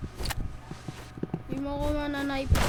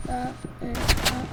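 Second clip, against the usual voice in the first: usual speaking voice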